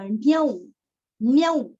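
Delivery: ण्यौ said loud with a clear au; ण्यौ with a stretched, stressed औ; au syllable stressed. Shown as a demonstration. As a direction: hit ण्यौ with the full au vowel. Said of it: "ण्या के ऊपर दो मात्रा".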